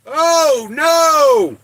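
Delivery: high, loud shout of dismay; sudden alarm. Real, not acted. Streamer realizes it is coffee time, loud cry. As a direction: cry out as if caught off guard.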